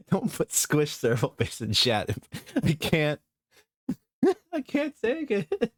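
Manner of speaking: high, laugh-choked words that keep breaking; can't stop laughing at chat; giggling through words. Real, not acted. Chat joke cracks up streamer.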